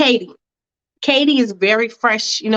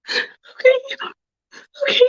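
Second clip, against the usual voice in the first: 1 sobbing. Acted. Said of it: "Mia's crying".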